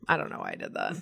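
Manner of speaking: ordinary talk, nothing marked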